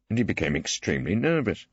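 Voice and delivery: ordinary delivery, nothing marked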